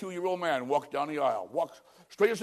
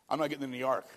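Noah refusing; 4 semitones lower, 6 dB quieter than usual. Retold or imitated. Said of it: "Noah could have stood outside and said".

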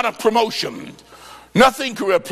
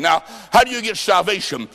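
ordinary delivery

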